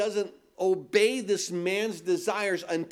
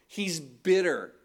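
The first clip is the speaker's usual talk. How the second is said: ordinary talk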